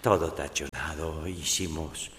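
slow, low, soft confiding; hushed confession. Acted. Woman confessing her night to the priest, hushed and slow. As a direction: linger softly over each romantic detail.

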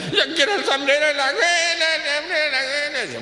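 put-on high whining child voice; whiny child. Spoiled kid whining to his father for the hat.

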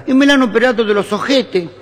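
put-on high, emphatic Italian-accented announcement; Italian husband. Italian husband explaining the operation to neighbour, high accented blurting. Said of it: "Hay un italiano".

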